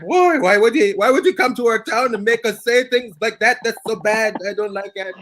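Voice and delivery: high-pitched mock complaint, sinking at the end; upset whining. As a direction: play the wounded, upset hometown player. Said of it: "over there all upset".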